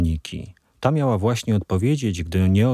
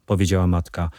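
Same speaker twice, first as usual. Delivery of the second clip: ordinary delivery, nothing marked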